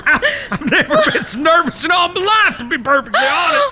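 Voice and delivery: relieved laughing confession; nervous laugh. Acted. Nervous relief after proposal.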